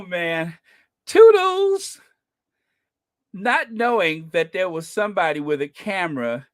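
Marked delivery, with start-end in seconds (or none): 1.1-2.0 mocking toodles. Mocking his waving goodbye.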